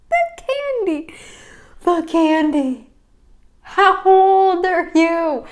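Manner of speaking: slow, high, loud disbelief; incredulous. Real, not acted. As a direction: drag it out in disbelief. Incredulous at candy diet.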